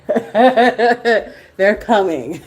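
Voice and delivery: laughing it out slowly; amused, drawn-out laugh. Real, not acted.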